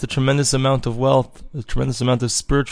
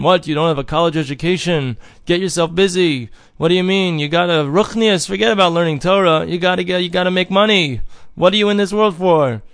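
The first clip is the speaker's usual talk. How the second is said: pushy, higher mocking voice; higher, hectoring voice of the evil inclination; tempting inner voice; 5 semitones higher than usual. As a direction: nag like a worldly tempter. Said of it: "our Yetzer Hara wants to tell us otherwise".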